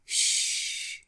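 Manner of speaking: unvoiced shush. Gregory hushes the child.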